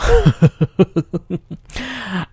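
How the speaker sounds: loud, high run of chuckles; real laugh. Real, not acted. Podcaster amused by how easy it all was, laughing. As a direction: let a long laugh bubble out.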